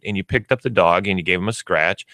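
ordinary talk, nothing marked

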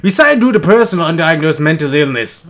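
ordinary talk

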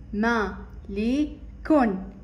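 slow ما لي كون with gaps between; syllable by syllable. Shown as a demonstration. Teacher sounding out مالك for children, slowly. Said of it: "متكونة من ثلاثة مقاطع".

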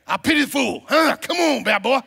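loud, high gruff tough-guy shout; Mr. T's rough accent, shouted; Mister T impression. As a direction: bark it like Mr. T taunting. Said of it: "Mister T, right?".

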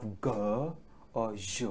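G as hard "guh", Je as soft "zhuh"; hard G, then a soft zh for Je; two G sounds. Shown as a demonstration. Teacher explaining two sounds, G "guh" then Je "zhuh". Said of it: "J has two sounding".

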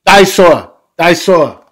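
put-on very loud, high small-child shout; excited little kid, each one falling; George's toddler voice. A narrator's reading. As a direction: yell the favorite word like a happy toddler. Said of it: "You know what George says?".

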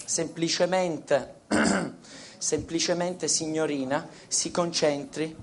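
soft, slow hypnotist voice; voce da ipnotizzatore. Acted. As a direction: lull her into a trance, softly. Ipnotizza la signorina. (voce ipnotica, lenta e sommessa)

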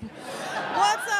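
high, drawn-out whooped greeting; mock party holler. Mock celebratory cry about getting pregnant.

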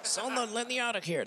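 thrown voice, as if trapped in the suitcase; muffled voice from the luggage. Retold or imitated. Ventriloquist throwing a muffled cry from the suitcase. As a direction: call out faintly, as if shut inside the bag. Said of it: "I'll go".

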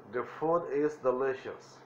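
"The food is delicious" slowly, stress on "food"; drawn out for learners; slow teacher demo; slow model phrase. Shown as a demonstration. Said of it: "traten de imitar mi voz".

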